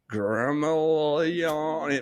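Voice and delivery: mock the mangled Gremlin; butchered name. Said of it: "they just completely like".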